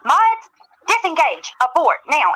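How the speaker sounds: slow, barked drill sergeant command. Retold or imitated. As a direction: bark orders like a drill sergeant. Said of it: "She sounded like a drill sergeant".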